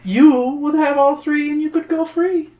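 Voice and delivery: high coaxing troll; wheedling troll. Acted.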